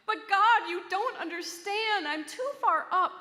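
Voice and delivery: loud, high, panicked protest; a frantic shout, high and loud; yelling back. A narrator's reading. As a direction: yell up at God that he's too far. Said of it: "He yelled back".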